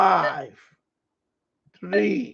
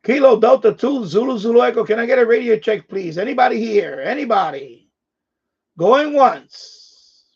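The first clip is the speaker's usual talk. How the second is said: radio operator call; 6 semitones higher, 8 dB louder than usual. Acted.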